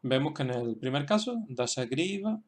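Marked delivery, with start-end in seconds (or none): 1.5-2.4 "Dashagriva" with a long, accented GRI; stress on GRI. Shown as a demonstration. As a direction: model "Dashagriva", leaning on the third syllable GRI. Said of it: "se queda ahí el acento".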